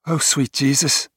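lightly voiced Liverpool mate in shock; Scouse accent. A narrator's reading. Tom reacts from the cabin gloom, Scouse accent. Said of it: "Tom's Scouse accent".

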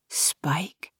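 soft unvoiced whisper. A narrator's reading. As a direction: breathe his name. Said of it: "Kay whispered".

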